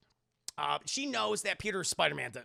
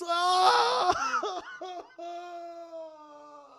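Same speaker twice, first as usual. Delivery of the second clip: high, loud crying wail; wailing sob; 14 semitones higher, 7 dB louder than usual. Retold or imitated. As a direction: let out an anguished wail. Peter's grief over Gwen.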